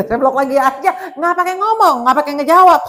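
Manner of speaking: loud, high, laughing at the start; gleeful defiance. Real, not acted. Pastor telling congregation about blocking the scammer, loud glee.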